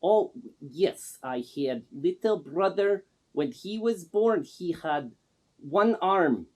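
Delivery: broken English in a put-on Russian accent; Man Gaga's Russian accent. Man Gaga introduces himself to the interviewer in a put-on Russian accent. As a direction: play a self-styled star with a thick fake Russian accent. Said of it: "The first thing I notice is the accent".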